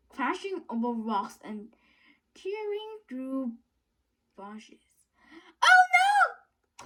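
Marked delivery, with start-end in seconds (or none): 5.6-6.9 high, loud cry; called out. A narrator's reading. Wilf calling out as the lorry slides. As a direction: cry out in alarm. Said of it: "called Wilf".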